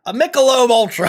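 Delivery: loud hammed-up reveal; mock hype. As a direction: announce it like a beer ad. Hyped beer reveal, then just kidding.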